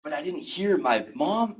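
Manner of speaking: normal talking voice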